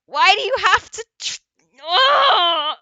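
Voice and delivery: high, very loud exasperated protest; exasperated outburst. Real, not acted. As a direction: burst out loud, ending in a frustrated groan.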